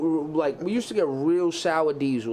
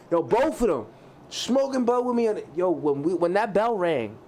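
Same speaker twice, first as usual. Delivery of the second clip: usual speaking voice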